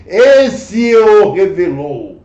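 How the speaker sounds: slow, weighty emphasis on esse; slow emphatic stress. Acted. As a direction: drag out esse o revelou for weight. Preacher stresses key phrase slowly. (ênfase lenta e pesada em esse)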